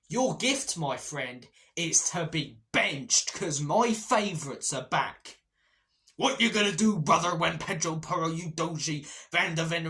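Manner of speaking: loud, slow, hammed-up taunting boss; milk each word like a wrestling promo; gloating manager. Manager taunting Archie about benching him, hammed-up loud gloat. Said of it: "this is what Andrew said".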